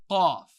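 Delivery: loud mock cough. Shown as a demonstration. Teacher fakes a cough to teach qaf. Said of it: "just behind that little dangly thing".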